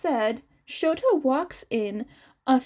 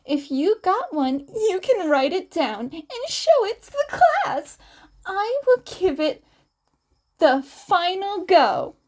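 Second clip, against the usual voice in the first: higher, playful teacher voice; higher playful woman's voice; flirty Midnight; 7 semitones higher, 3 dB louder than usual. Acted. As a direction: coax the students sweetly.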